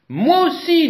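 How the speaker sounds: high, loud eager little voice; high eager mongoose. A narrator's reading. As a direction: jump in eagerly. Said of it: "dit Mangouste".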